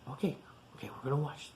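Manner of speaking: hushed, breathy whisper to the class. Real, not acted. Teacher whispering to the children before the story.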